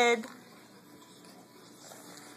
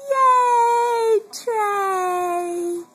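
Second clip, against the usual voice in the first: excited cheer; 7 semitones higher, 3 dB louder than usual. Real, not acted. Mother cheering her baby for feeding himself, high and bright. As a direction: cheer him on.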